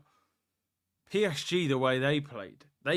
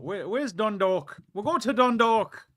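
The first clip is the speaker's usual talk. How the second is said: put-on a light Irish accent, higher and louder; mock Irish accent, lightly done; 6 semitones higher, 5 dB louder than usual. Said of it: "That's meant to be my Irish accent".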